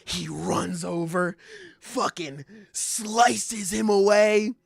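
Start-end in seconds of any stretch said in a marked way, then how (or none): none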